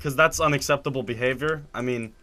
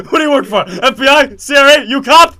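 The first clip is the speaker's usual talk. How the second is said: high, very loud rapid-fire demands; hammed-up grilling, building louder; mock interrogation; 11 semitones higher, 15 dB louder than usual. Joking interrogation of attendant.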